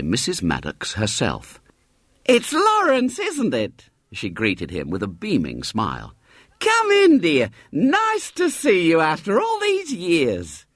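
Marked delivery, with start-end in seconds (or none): 2.2-3.9 put-on much higher sweet old lady voice; motherly lady. Mrs Maddox greeting the boy at the door, high and motherly. 6.6-10.7 put-on much higher sweet old lady voice; motherly lady. Mrs Maddox greeting the boy at the door, high and motherly.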